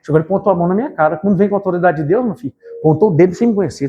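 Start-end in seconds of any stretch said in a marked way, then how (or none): none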